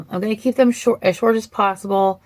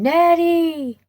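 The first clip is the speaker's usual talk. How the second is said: high ringing call of Nettie's name, not much louder; 10 semitones higher, 3 dB louder than usual. Retold or imitated. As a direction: call her sister's name across the field. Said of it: "she just screams out her name".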